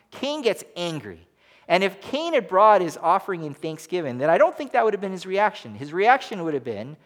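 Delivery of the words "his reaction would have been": normal talking voice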